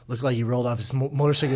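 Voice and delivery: low, soft, hurried cover story; mock sheepish excuse. Teammates covering for a friend to the coach, mock sheepish. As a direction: offer it meekly, low and quiet. Players mumbling a lie to coach.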